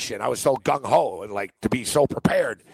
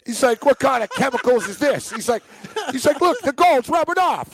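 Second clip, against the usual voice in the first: higher and higher, mock disgust at a fake watch; mock outrage, building; 8 semitones higher than usual. Manager mocking the fake watch.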